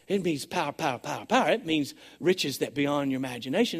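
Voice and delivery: soft, awed "power, power" to the congregation; hushed preaching. Real, not acted. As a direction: drop to an awed near-whisper on "power". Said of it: "power repeated, hushed".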